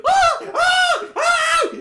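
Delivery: very high, loud screams of terror; repeated high-pitched victim screams; deafening scream. Acted. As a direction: scream like a dog has tackled you. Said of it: "scream is gonna be deafening".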